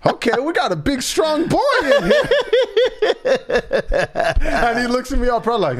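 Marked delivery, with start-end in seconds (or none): 0.0-2.3 high, hammed-up praise, laughter creeping in; impressed doctor. Doctor praising the boy's forceful answer, imitated high praise. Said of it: "and she said". 2.3-4.4 high, real cackling; laugh. Real, not acted. Host cracking up over the doctor's reply, high real laugh.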